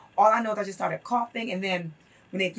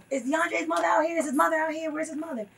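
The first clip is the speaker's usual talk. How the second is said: higher-pitched imitation of calling out to a crowd; retold mic announcement; 6 semitones higher than usual. Organizers calling for a prisoner's mother, imitated urgent appeal. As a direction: retell an urgent search over a mic. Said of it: "they were on the mic like".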